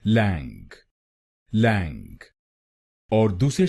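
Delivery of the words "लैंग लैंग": लैंग said on its own, low and falling; first syllable alone. Shown as a demonstration. Teacher breaks the word into syllables, lower and deliberate. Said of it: "हम बोलेंगे".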